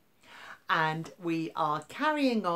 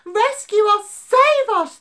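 very high, very loud terrified shrieks; frantic plea Save us; shrieking; 16 dB louder than usual. Acted. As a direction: shriek for rescue. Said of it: "they shrieked".

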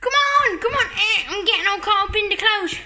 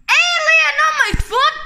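high, loud laughing cry; laughing shout; 7 semitones higher, 5 dB louder than usual. Pretend. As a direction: yell it out while laughing. Kids panicking over toy alien.

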